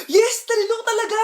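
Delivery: high, delighted student cheer; gleeful celebration. Acted. Student celebrates top exam score.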